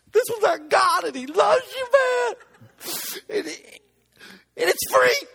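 loud, teary blessing shouted to the customer; emotional shout. Retold or imitated. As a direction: blurt it out overcome with emotion.

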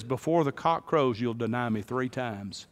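Jesus spoken soft and low; quiet prophecy. Retold or imitated. Preacher voicing Jesus to Peter, soft and grave. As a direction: say it softly, like a grave warning. Jesus voiced in retold story.

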